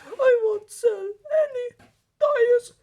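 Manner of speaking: high, loud, slow horrified cry; horrified wail. Acted. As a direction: wail it in horror. Luigi in horror.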